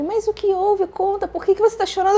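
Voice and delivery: high-pitched, quick retold questioning; imitated worried partner. Partner asking why she is crying, imitated alarm. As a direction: press for an answer in mock alarm.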